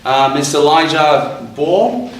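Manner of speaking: ordinary talk, nothing marked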